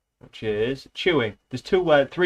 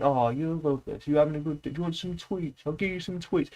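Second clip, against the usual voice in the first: higher, softer cooing at a pet; baby talk to a cat; 4 semitones higher, 5 dB quieter than usual. Retold or imitated. Imitating a friend who coos at his cat, higher and softer. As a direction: coo at the cat like a doting owner. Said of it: "he's just talking to his cat going".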